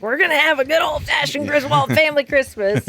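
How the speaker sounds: Chevy Chase. Retold or imitated. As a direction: declare it like an overeager family dad. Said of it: "Chevy Chase is, you know, he's like".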